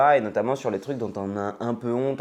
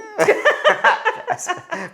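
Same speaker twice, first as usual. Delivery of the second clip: high laugh; 10 dB louder than usual. Real, not acted. Joke about astrology.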